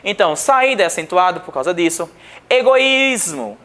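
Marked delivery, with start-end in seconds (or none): 2.5-3.6 "Egoísmo" slow and higher, with a long Í; tonic vowel stressed. Shown as a demonstration. Teacher showing that the I in "Egoísmo" is tonic, held long. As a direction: stretch the "í" in "Egoísmo". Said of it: "o I é tônico".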